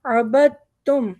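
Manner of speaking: अबदतुम with बा joined straight to ता; silent dal. Shown as a demonstration. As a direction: drop the दाल in अबदतुम. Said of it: "दाल नहीं पढ़ेंगे, बा को सीधा ता पे मिलाएंगे". (दाल छोड़कर बा को ता से मिलाएँ)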